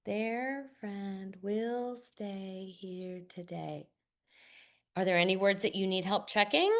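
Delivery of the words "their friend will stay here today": slow, hushed whisper reading; slow whispered read-through. Shown as a demonstration. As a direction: read it softly, word by word. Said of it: "I ask students to whisper read".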